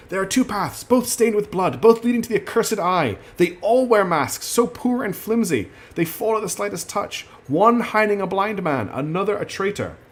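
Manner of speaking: Idra's prophetic voice. Retold or imitated. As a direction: intone it like a half-mad oracle. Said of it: "I can't do her accent".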